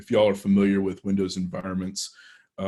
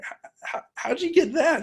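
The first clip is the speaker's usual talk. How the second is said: much higher, stuttering gasp of disbelief; stammering astonishment. Retold or imitated. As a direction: stumble over the first sound, then squeak the question in shock. Stunned by colleague's passwords.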